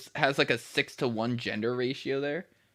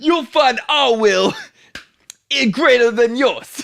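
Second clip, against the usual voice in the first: very loud, high, slow mock Brooklyn accent; 12 semitones higher, 10 dB louder, slower than usual. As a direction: declaim it in an over-the-top Brooklyn accent. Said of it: "no one sounds like that in Brooklyn".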